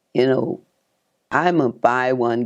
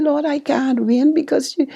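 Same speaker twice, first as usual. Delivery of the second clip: pitched-up lament over having nothing; high pleading prayer; 12 semitones higher than usual. Retold or imitated. Begging the Lord she has no clothes: high plea. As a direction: plead with God, voice raised high.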